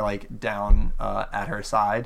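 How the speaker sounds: ordinary talk, nothing marked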